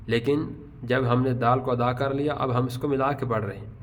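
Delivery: normal talking voice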